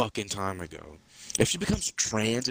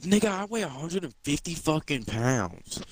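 higher-pitched retold protest; indignant protest; 6 semitones higher than usual. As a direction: protest as if wrongly accused. Retold protest to nagging dad.

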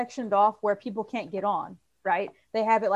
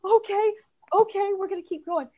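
breathless agreement; 10 semitones higher, 4 dB louder than usual. Retold or imitated. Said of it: "I was like".

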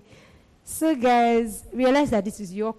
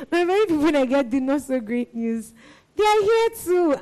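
real choked-up laughter; 5 semitones higher, 5 dB louder than usual. Real, not acted. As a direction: voice rising high with emotion. Still overcome, thanking the community.

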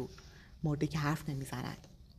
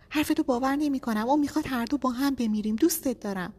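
high, loud frantic pleading; a high, loud, panicked appeal under a countdown; desperate plea; 9 semitones higher, 6 dB louder than usual. A narrator's reading. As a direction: cry out to him before time runs out. Pleading with husband at gunpoint.

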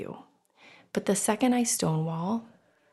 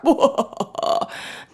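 loud, higher villainous cackle; mock villain laugh; 7 semitones higher, 5 dB louder than usual. Podcaster mocking a power-hungry manipulator, mock villain laugh. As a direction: cap the scheme with a put-on evil laugh.

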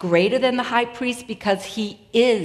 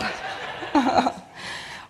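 short breathy laugh after the joke; 3 semitones higher, 5 dB quieter than usual. Real, not acted. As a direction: chuckle at your own exaggeration. Laughs at own exaggerated story.

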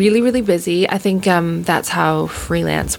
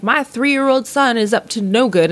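lightly voiced stand-up setup, a little higher; mock comic bit; 7 semitones higher than usual. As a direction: tease a comic's stock opening. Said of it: "Or they'll be like".

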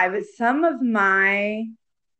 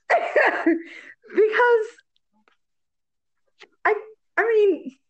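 laughing; 12 semitones higher, much slower than usual. Real, not acted. Laughing embarrassed.

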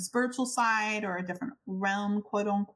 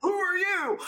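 high, loud startled cry; startled shriek; 11 semitones higher, 3 dB louder than usual. Retold or imitated. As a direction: blurt it out, jumping back in fright. Startled by an approaching stranger.

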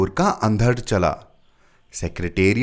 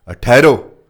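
much louder order to stop; commanding halt; 3 semitones higher, 12 dB louder, slower than usual. A narrator's reading. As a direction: stop them firmly. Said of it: "superintendent बोला".

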